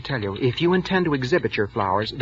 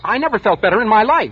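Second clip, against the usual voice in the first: sharp, louder snap at a question; snapping; 8 semitones higher, 5 dB louder than usual. Acted. Clerk snaps at the secretary over a cold, sharp and raised. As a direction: bite back defensively. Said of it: "I didn't mean to snap at you".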